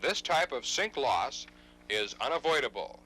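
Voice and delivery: normal talking voice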